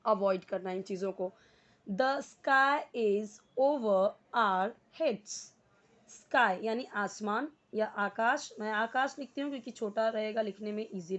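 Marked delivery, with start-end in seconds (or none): 1.9-5.4 very slow, word by word; slow model reading. Shown as a demonstration. Teacher reads the sentence slowly for students to copy. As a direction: read each word out for students to repeat. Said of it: "जैसे जैसे मैं जिस word को पढ़ूँ आपको उसी तरीके".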